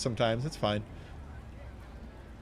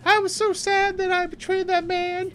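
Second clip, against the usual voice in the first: very high mock little-girl wail; hammed-up girlish lament, pitched way up; 3 dB louder than usual. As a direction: squeal it like a remorseful child. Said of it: "when she goes home to her dad".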